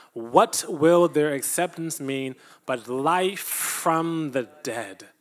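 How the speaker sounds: slow, loud, solemn reading of scripture. Acted. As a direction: proclaim each verse slowly and loud to the congregation. Reads Romans aloud with congregation.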